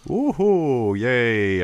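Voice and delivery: excited cheer. Real, not acted. Cheering a good card draw.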